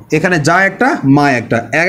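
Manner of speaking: usual speaking voice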